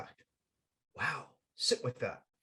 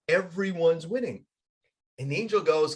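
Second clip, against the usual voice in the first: ordinary delivery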